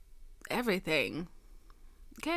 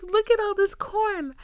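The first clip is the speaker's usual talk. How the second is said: much higher, much louder mock awe; hammed-up awe at the endless corn; hammed-up boyfriend; 13 semitones higher, 11 dB louder than usual. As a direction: belt his amazement as a parody. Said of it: "He's like".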